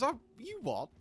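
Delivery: high, startled "you what?"; shocked disbelief. Acted. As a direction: squeak it out in sudden alarm. Patient shocked by castration news.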